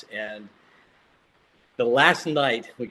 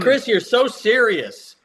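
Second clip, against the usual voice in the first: teasing; 9 semitones higher, 6 dB louder, slower than usual. Real, not acted. Teasing the host.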